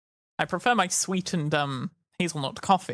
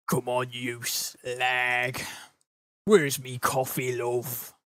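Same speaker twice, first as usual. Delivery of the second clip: lower, slow English geezer demand; Keizo impression; 5 semitones lower, much slower than usual. Demanding coffee from wife in a Keizo geezer voice. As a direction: grumble for coffee like a lad. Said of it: "that's my Keizo's reference, uh, Keizo impression".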